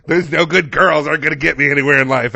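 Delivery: high, very loud comic outburst; loud exasperation. Real, not acted. Answering co-host's "what does that mean", loud exasperation. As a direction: blurt it out at full volume. Blurting reply to co-host's laughing question.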